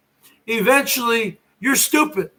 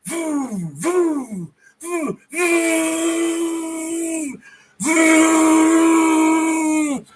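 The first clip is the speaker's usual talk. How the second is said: chainsaw noise; 5 semitones higher, much slower than usual. Retold or imitated. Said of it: "pull on that chainsaw".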